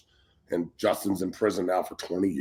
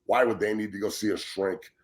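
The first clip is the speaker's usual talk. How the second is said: ordinary delivery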